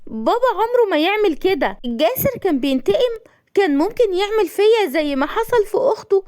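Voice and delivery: high, anguished disbelief; pained. A narrator's reading. Tahani learns of her father's deed, pained and high. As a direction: plead in a high, hurt voice. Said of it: "وقالت بألم".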